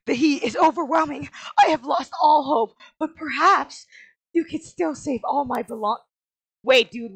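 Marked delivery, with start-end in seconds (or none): 0.1-6.0 loud, high, hammed-up despair; melodramatic fire victim. Game character begging for rescue from a house fire, melodramatic pleading. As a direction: wail it like an overacting disaster victim.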